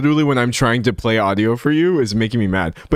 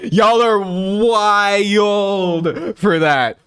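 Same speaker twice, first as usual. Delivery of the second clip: higher, very slow, stretched out in mock outrage; drawn-out disbelief; 8 semitones higher, much slower than usual. Real, not acted. Streamer to viewers, slowly stretched-out disbelief. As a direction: drag every word out in disbelief.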